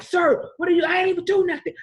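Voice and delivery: high, pleading, near-shouted protest; a frightened man crying out that he did nothing; panicked protest. Retold or imitated. Driver pulled over pleads with the cop, high and frightened.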